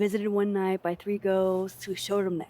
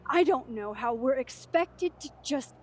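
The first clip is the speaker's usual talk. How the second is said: higher-pitched, upset insistence, stressing murdered; anguished protest; 5 semitones higher than usual. Acted. Student breaking up a tribute to a murdered friend, anguished protest. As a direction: protest with rising distress.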